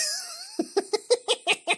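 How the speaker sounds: high-pitched giggling fit; real laugh, cracking up; helpless laugh. Real, not acted. Cracking up at an image.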